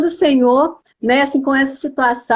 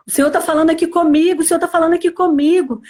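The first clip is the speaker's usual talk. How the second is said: quicker, higher, repeated announcement; excited news, retold; 4 semitones higher, faster than usual. Husband phoning his wife that God is speaking, excited. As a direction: blurt it out like astonished news, lightly acted.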